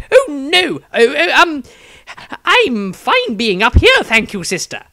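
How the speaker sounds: nervous wizard fending off an admirer; flustered wizard. Acted. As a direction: squirm out of it politely. Maestro flustered refusal.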